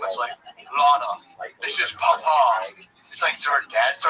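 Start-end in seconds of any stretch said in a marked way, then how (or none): 0.6-2.7 slow, booming father from the sky; sky voice from The Lion King. Retold or imitated. Dad addressing his daughter Lana in a letter, mock-solemn. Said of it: "sounds like the sky from the Lion King".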